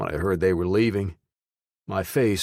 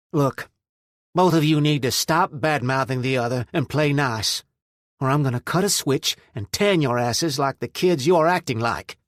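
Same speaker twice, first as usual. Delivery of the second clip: louder, higher scolding voice; stern scolding; 7 semitones higher, 4 dB louder than usual. A narrator's reading. Mother scolding two squabbling adults, firm and loud. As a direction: lay down the law like a fed-up mother.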